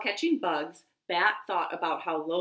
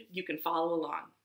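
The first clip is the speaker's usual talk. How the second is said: plain reading voice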